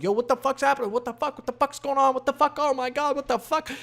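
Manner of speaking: high-pitched panicked rush; imitated freak-out. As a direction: rattle it off high, like someone losing it. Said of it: "freaking out".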